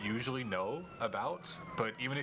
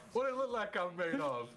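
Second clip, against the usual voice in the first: high-pitched LA accent imitation; put-on LA accent, voice raised high; 10 semitones higher than usual. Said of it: "with L A accent".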